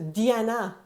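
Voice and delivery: Diana as "dee-ah-NA"; French pronunciation of Diana. Shown as a demonstration. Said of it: "in France".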